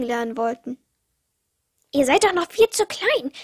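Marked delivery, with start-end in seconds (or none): 1.9-3.3 higher, louder scolding croak; croaking uncle raven. A narrator's reading. Uncle raven to the hungry chicks, croaking loudly. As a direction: crow it out at the youngsters, pitched up. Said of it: "krächzte unser Onkel".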